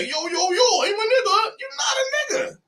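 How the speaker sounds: very high, wailing mock shout; hammed-up cry of rejection; mock indignant family. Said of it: "be like".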